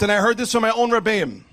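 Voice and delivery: ordinary talk, nothing marked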